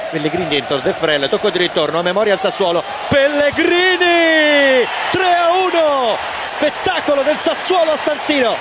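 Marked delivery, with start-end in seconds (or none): 3.1-8.6 goal shout. Real, not acted. Goal call in live commentary.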